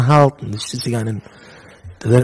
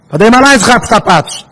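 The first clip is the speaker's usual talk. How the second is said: raised, higher-pitched exclamation; emphatic admonition; 5 semitones higher, 4 dB louder than usual. Real, not acted. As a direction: repeat it forcefully to drive the warning home. Louder repeat of admonition.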